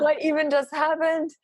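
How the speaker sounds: high, astonished question; amazed disbelief. Retold or imitated. New mother just after the birth, high-pitched amazement.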